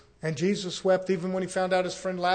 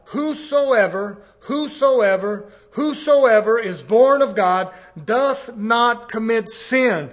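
louder and slower, every word hammered; slow, thundering preaching; 2 semitones higher, 8 dB louder, much slower than usual. Acted. Preacher driving the verse into the congregation, slow and thundering. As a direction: drag out whosoever like a revival call.

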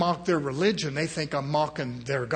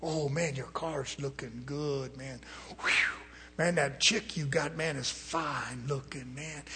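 flatterers; 3 semitones lower, 8 dB quieter than usual. Retold or imitated. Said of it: "I watched the people around him".

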